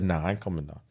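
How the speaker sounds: lightly voiced sulking little brother; sulky kid refusal. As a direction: mumble it like a kid who won't budge. Brother refusing, retold in story.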